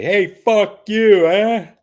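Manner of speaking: loud, high, drawn-out New York greeting; mock New Yorker holler; brash New York street accent. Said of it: "like being in New York".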